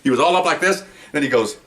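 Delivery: normal talking voice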